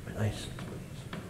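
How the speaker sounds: whispered repeat of the cop's words; under-breath mumble. Retold or imitated. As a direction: mutter it almost silently. Teen mumbling back to cop.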